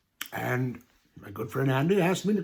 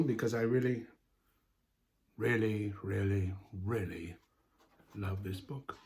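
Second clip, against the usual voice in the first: mock emotional; 5 semitones lower, 7 dB quieter than usual. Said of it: "pardon me if I get a little emotional".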